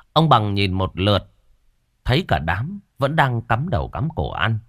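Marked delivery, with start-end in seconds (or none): none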